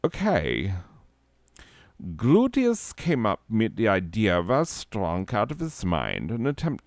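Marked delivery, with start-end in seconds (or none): 2.2-6.8 mock German narrator reading; German accent. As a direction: read it in a put-on German accent. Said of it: "I don't know why I went German".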